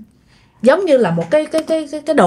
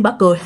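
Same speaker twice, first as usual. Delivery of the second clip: booming laugh; 12 semitones higher, much faster than usual. Retold or imitated. Retelling the neighbor's possessed laugh, hammed up. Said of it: "bả cười lớn lên vậy đó".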